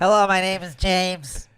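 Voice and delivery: slow, high mock nasal voice. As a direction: do a nasal voice slowly. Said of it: "my nasally voice".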